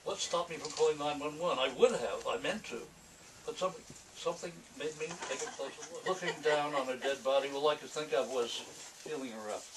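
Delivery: a hint of a brooding noir narrator; noir monologue reading. Author reading his noir piece aloud, lightly brooding. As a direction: muse aloud like a hard-boiled confession.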